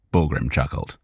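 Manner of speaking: usual speaking voice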